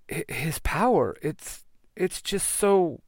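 halting and drawn-out, trailing off; shaken, faltering. Acted. Kim to the team after the defeat, shaken and faltering. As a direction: stumble over it, lost for words.

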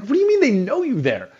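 retold incredulity, pitched high; can't believe the shop knows him; incredulous. Guest questions his colleague about the shop, retold incredulity.

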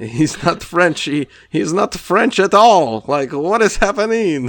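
high, drawn-out incredulity; mock bafflement. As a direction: stretch the words in exaggerated disbelief. Acting out his own baffled question.

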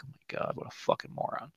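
breathy under-the-breath whisper; whispered aside. Retold or imitated. Talking about the blonde cousin in a hushed whisper. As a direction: mutter it so the cousin can't hear.

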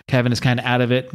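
ordinary talk

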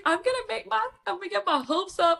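squealing high and fast; panicked glee, voice shooting way up; excited squeal. Retold or imitated. Retelling her reaction to the fan's message, squealing high. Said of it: "I was like".

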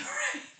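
sudden high, loud laugh; high burst of laughter. Real, not acted. Laughing at her own title idea, a high loud burst. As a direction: crack up at her own joke.

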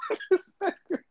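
high pitched laughing question; laughing disbelief. Retold or imitated. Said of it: "and I'm like".

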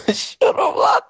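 can't keep a straight face; laughing. Real, not acted. Laughing as he tells the heckler off. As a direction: shut up, lad, laughed out breathily. Laughing while telling him off.

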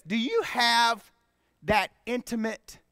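ordinary talk, nothing marked

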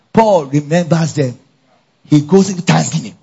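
usual speaking voice

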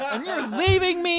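high, laughing mock boss. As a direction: whine it like a hurt boss. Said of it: "a boss coming in being like".

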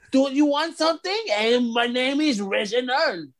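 hammed-up French accent; mock French accent. Podcaster mocking a wrestling character's bad accent, hammed-up French accent. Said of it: "Do a French accent".

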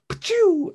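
mock fireball sound from the hands; fire blast sound effect. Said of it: "shoot some fire out of your hands".

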